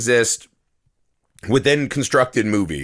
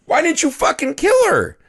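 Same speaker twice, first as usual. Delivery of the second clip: much higher, incredulous rant; exasperated disbelief; 11 semitones higher than usual. Retold or imitated. Viewer scolds the movie's killer daughter, high-pitched exasperation. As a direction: pitch climbing as the disbelief builds. Mocks the daughter's illogical plan.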